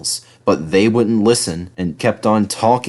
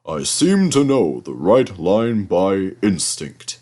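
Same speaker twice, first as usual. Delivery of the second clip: proud Gordon. A narrator's reading. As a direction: puff yourself up, boastful big engine. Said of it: "said Gordon proudly".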